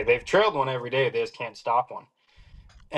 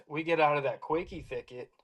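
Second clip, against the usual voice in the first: ordinary delivery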